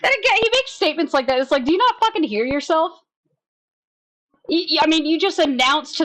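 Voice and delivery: high, incredulous; can't believe what he said; incredulous rant. Real, not acted.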